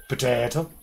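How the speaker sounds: mock Irish accent with a rising end; playful Irish accent. Shown as a demonstration. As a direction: say it as a lightly Irish question. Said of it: "do it in an Irish accent".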